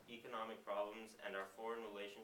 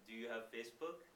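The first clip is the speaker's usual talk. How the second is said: put-on thick Middle Eastern accent, questioning; imitating a Middle Eastern checkpoint guard's accent. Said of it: "very, very thick Middle Eastern accent".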